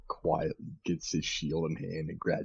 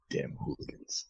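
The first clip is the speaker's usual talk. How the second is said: muttered; 8 dB quieter than usual. Acted. Grumbled aside after calling.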